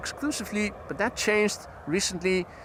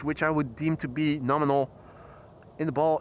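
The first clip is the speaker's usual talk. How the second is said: usual speaking voice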